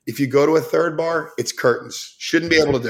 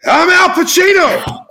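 loud, much higher, slow indignant correction; Al Pacino; 8 dB louder than usual. Retold or imitated. Offended star correcting a drunk fan in the bathroom, loud and high. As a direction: bellow the name back, offended. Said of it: "it was fucking Al Pacino".

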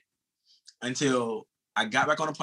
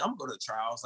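softer, tossed-off claim; casual; 2 semitones lower, 7 dB quieter than usual. Retold or imitated. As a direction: say it as if it's nothing. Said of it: "used to say it so casually".

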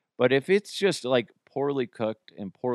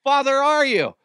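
much higher and louder, scandalized outburst; shocked father-in-law; 13 semitones higher, 7 dB louder than usual. Retold or imitated. Father-in-law appalled at his parenting, high shocked cry. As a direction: play the outraged in-law, voice jumping up. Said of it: "the way my father-in-law looked at me".

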